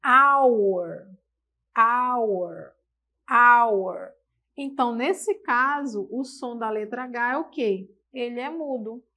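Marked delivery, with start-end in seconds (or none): 0.0-4.1 Hour called out loud, no H sound; repeated model word. Shown as a demonstration. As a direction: drill Hour loudly three times. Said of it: "Ficaria com som de A". (Hour bem alto, sem som de H)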